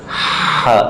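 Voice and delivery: Ha breathed from the middle of the throat, airy and unvoiced; Arabic ḥa from the throat; throaty ha. Shown as a demonstration. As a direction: push Ha from the mid-throat as a breathy rasp. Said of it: "Bukan seperti ha biasa".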